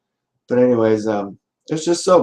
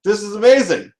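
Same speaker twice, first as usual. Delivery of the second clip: excited guy; 10 semitones higher than usual. Acted. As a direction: gush like he can't believe his luck. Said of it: "He's like".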